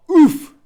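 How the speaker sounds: very high, loud yelp of pain; loud pained oof. Real, not acted. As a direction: blurt a big startled oof. Taking a hit in the game.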